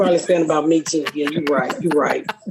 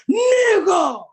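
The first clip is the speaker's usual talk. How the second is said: excited shout; 10 semitones higher than usual. Retold or imitated. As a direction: yell it like a fan spotting her.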